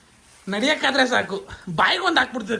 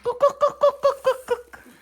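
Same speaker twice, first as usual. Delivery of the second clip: mock stammer; 11 semitones higher, much slower than usual. Son mocks his mother stammering.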